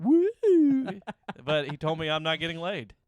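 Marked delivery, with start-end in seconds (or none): 0.0-1.0 high, loud mock whoop; laughing hammed-up whoop. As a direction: send him off with a cheer. Creep vanishing into crowd.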